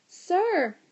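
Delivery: a high, loud yell of sir. A narrator's reading. Boy grabbing a teacher, yelling sir. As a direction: yell to grab the teacher's attention. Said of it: "he yelled".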